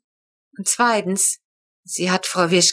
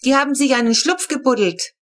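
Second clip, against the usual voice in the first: higher call carried across the fence; a lightly raised call to the others; girl calling over the fence about the dug tunnel; 6 semitones higher, 3 dB louder than usual. A narrator's reading. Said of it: "rief Flo uns über den Zaun zu".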